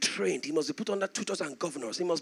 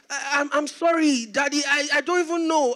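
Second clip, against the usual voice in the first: stammered, higher-pitched apology; afraid; 7 semitones higher, 5 dB louder than usual. Retold or imitated. Afraid son apologizing to Daddy, halting and higher. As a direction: apologize nervously, acting the frightened son. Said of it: "Tanimola was afraid".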